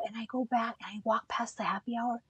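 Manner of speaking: hushed whisper sneaking past. Retold or imitated. Tiptoeing past the band at happy hour, she whispers. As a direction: breathe it out secretly.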